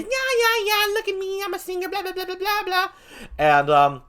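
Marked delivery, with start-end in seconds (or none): 0.0-2.9 very high, silly show-off voice; high-pitched mock bragging; mocking vain singer. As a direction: squeal like a self-obsessed singer, babbling away. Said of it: "he would get angry at anybody who would criticize his great voice".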